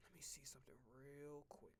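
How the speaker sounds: soft whisper to himself. Real, not acted. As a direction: whisper while looking it up. Muttering to self.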